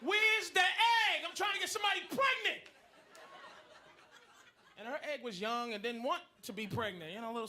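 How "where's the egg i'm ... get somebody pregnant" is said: angry sperm shout. Acted. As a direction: storm in demanding the egg. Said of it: "my fucking sperm was like".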